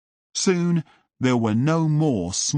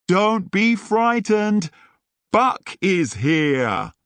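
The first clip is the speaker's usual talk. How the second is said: higher, slow, bold group shout; shouted together; 8 semitones higher, 3 dB louder, slower than usual. Acted. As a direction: declare it slowly, like a rallying cry. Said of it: "everyone shouted".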